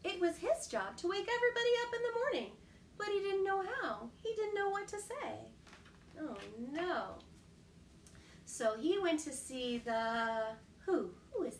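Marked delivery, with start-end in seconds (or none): none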